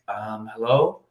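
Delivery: soft, hesitant "um" into a muted hello; imitating a low-energy phone answer. Woman answering a sales call, low energy. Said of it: "Maria kinda talking a little bit like".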